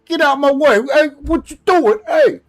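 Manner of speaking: loud, high Boston and New York yell; East Coast accent. Shown as a demonstration. New York pedestrian yelling to get out of the way, loud East Coast accent. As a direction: holler it like a pushy New Yorker. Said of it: "East Coast where you got Boston and New York".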